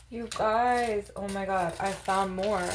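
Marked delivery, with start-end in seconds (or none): none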